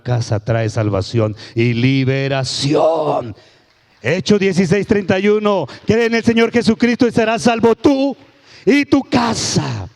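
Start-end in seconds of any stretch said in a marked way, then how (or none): 4.0-9.9 high, loud fiery preaching; preaching at full force. Acted. Pastor booming at the church about the blasphemy, loud.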